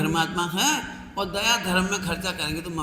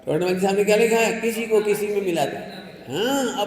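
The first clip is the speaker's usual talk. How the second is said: normal talking voice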